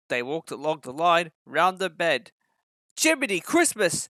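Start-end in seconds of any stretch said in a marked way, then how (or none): 3.0-4.1 higher, startled outburst; surprised exclamation. A narrator's reading. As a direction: blurt it out in shock at the landslide. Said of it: "exclaimed the driver".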